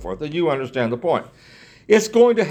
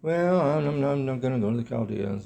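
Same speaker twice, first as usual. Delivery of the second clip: soft, hesitant, half-swallowed denial; meek mumble; 3 semitones lower, 8 dB quieter than usual. Shown as a demonstration. Preacher showing how Jeremiah did not answer, meekly. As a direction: mumble it timidly, almost apologetic. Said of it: "You don't get the sense that he said".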